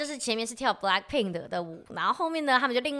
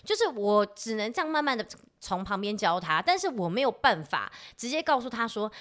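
normal talking voice

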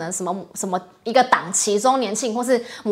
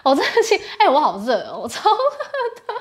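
excited and laughing; 9 semitones higher than usual. Real, not acted. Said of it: "我真的很兴奋，很嗨".

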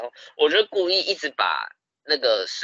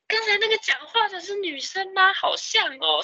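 much higher, surprised question; mock high curious gamer; curious gamer stranger. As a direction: mimic a stranger pitched way up. Strangers in game chat ask.

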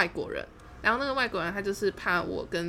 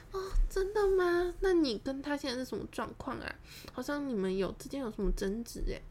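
feigned innocence; 7 semitones higher than usual. Retold or imitated. Asking the man about his ex, feigned innocence. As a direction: act sweetly clueless, pitched up.

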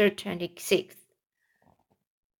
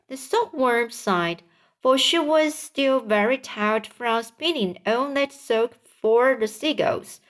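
ordinary delivery, nothing marked

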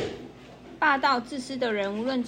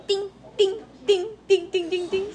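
mock stinging buzz; 9 semitones higher, 5 dB louder than usual. Mosquito stings lion repeatedly.

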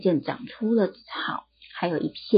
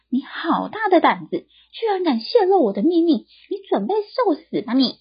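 a mild mock threat, higher; angry king; 8 semitones higher, 3 dB louder than usual. King confronting the barber, mildly angry.

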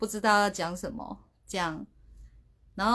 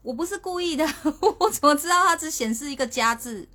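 flustered laughing protest; 7 semitones higher, 6 dB louder than usual. Retold or imitated. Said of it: "我说".

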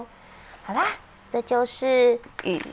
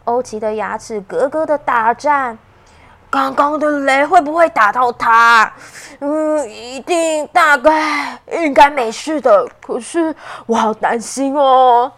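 worried character voice; 7 dB louder than usual. Acted. Ouqi worries about friend.

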